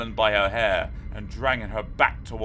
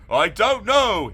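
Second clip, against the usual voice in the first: higher, louder, slower shout; shouted back; 12 semitones higher, 8 dB louder than usual. A narrator's reading. The father shouting back to the mother, higher and louder. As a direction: yell it back in confusion. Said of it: "he shouted back".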